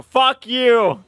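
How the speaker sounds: high, loud hammed-up thug shouting; mock mob yell. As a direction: bark it like a rabid mob. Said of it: "mobs that wanna come out and go".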